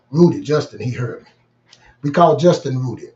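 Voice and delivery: normal talking voice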